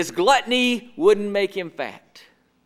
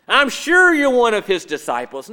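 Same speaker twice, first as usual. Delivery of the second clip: louder, leaning on sure; pressing accusation; 4 semitones higher, 7 dB louder than usual. Acted. Bystander accusing Peter by the fire, louder and pressing. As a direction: push the accusation hard at him.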